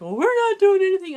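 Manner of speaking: high, loud imitation of protesting boys; mock protest. Boys denying it to the teacher, imitated indignant protest. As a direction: pipe up as the wrongly accused student.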